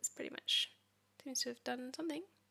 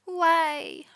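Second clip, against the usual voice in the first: frustrated shout; 4 semitones higher, 12 dB louder than usual. Real, not acted. Frustrated at failing code.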